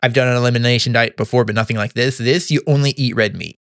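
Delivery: usual speaking voice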